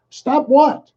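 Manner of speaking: high, dumb-sounding stop what; mock clueless reply. Mocking the other side, a clueless high stop what. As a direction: play the baffled opponent. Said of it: "half the country going".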